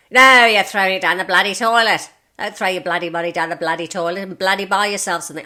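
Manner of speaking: loud, blunt telling-off with every bloody punched; forthright scolding. Retold or imitated. Doctor Wolf scolds her for renting, loud and blunt. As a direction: bark it like a bossy manager.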